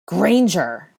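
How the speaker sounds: loud, hard shout of a surname; cold hard call. A narrator's reading. Malfoy calling Hermione across the corridor, loud and cold. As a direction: call her out sharply across the hall. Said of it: "a cold, hard voice".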